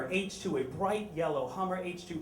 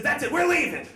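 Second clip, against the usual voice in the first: much higher, louder mock huff; storming out; 11 semitones higher, 8 dB louder than usual. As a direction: slam the door with your voice. Angry customer storms out.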